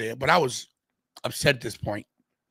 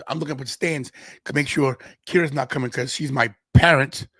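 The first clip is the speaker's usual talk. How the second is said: ordinary delivery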